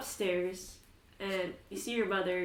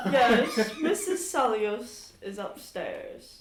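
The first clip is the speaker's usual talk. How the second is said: butler voice; 3 semitones higher, 8 dB louder, much slower than usual. Acted. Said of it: "do the best butler voice".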